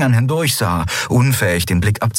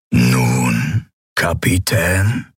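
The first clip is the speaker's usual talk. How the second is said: low opening, slow questioning rise; expectant emperor; 4 semitones lower, much slower than usual. Acted. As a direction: prompt the prisoner with unhurried menace. Kaiser fragt nach erster Folter. (lauernder Kaiser, tief und gedehnt fragend)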